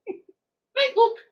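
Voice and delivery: tiny high-pitched creature voice; squeaky minion voice. Acted. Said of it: "looking down at you and saying".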